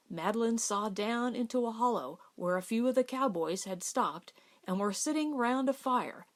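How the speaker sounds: ordinary delivery, nothing marked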